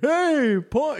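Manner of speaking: high, drawn-out fan greeting; imitated high-pitched hail; mock calling out. Imitates someone calling his name.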